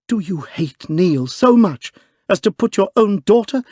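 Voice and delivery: high, loud furious woman; angry outburst. Acted. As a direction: rage at your father. Daughter confronts her father.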